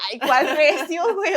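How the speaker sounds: high, loud laughing mock; laughing scoff. Real, not acted. Mocking her younger self while laughing. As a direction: laugh at your own silly claim.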